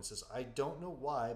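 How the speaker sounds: ordinary delivery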